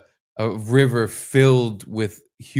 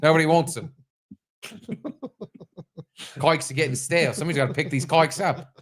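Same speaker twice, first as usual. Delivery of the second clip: Australian baker. Pretend. Baker selling stale cakes to customers, in a light Australian accent. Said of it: "how they say the word cake".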